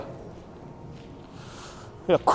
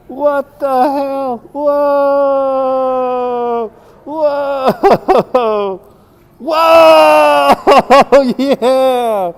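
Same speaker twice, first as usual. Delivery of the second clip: high, stretched-out whoas breaking into laughter; amazed cheering; 8 semitones higher, 4 dB louder, much slower than usual. Real, not acted. Spectator watching a hoop performer pull it off, high amazed cheering. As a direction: whoop in real awe.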